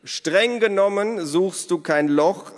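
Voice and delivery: high, loud, slow correction; calling out. A narrator's reading. Elos corrects Naru through the window, calling out. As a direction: call it out slowly and pedantically.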